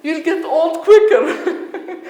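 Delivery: high, loud mock scolding mom, laughing. Mom scolding dad about his skin, high laughing mock scold. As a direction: imitate a laughing mother nagging, high. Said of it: "my mom screaming at him".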